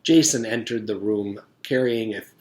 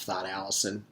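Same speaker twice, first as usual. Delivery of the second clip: ordinary delivery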